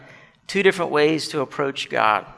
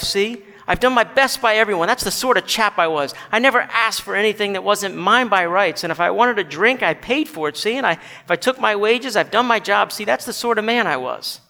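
higher, insistent self-defence; self-righteous ghost; 4 semitones higher than usual. Acted. Ghost defends his life to the bright man, self-righteous. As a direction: plead your own merit, raised in pitch.

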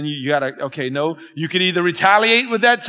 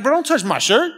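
higher-pitched playful indignation; mock mad; 6 semitones higher than usual. Preacher pretends to be mad at his helper, mock indignant. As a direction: act touchy and offended, lightly. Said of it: "I could get all mad".

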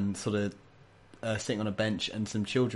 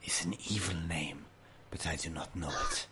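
salamander creature; 5 semitones lower, 8 dB quieter than usual. Acted. Salamander voice continues.

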